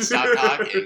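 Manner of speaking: loud, high retold telling-off; acted-out exasperated yell; exasperated scolding. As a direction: scold a drunk friend, raising the voice. Retold scolding of drunk friend.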